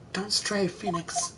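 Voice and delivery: hushed, halting counsel; softly guide, a phrase at a time; soft guiding voice. Acted. Mentor's voice reaching Phoenix, soft guidance. Mia's remembered voice advises Phoenix.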